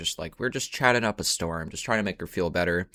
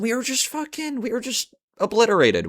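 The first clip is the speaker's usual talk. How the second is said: excited exaggeration; 3 dB louder than usual. Real, not acted. Boasting about being wasted.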